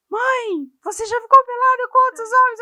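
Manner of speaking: high, loud imitation of a daughter; mock-outraged childish question; scandalised child. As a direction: demand an answer like an appalled kid.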